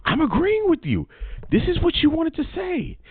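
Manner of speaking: high mock girly voice; high-pitched mocking quote of her; mocking woman's voice. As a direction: squeak it out like her. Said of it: "She said".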